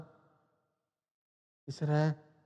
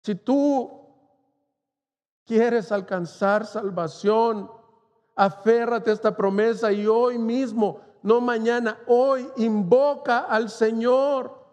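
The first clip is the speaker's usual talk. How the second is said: higher, slow insistent appeal; fervent preaching; 4 semitones higher, slower than usual. Acted. Preacher urging the congregation to seek salvation, fervent appeal. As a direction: press the listeners to decide today. Urges listeners to call on God.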